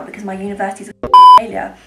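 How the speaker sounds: outburst. Retold or imitated. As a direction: blurt the last bit out loud in exasperation. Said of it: "I had to email the university saying".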